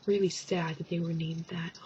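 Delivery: whispered pity. Pretend. Mock sympathy.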